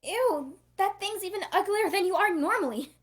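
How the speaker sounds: high, loud grossed-out jeer; disgusted. Acted. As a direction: sneer at it, totally grossed out. Grossed out by the alien.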